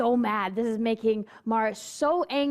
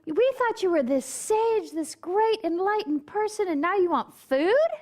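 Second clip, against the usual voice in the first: much higher, incredulous squeal; indignant disciples; 9 semitones higher than usual. Retold or imitated. Disciples protest to the Buddha who asks for food, high incredulous voice. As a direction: play the shocked followers, pitched way up.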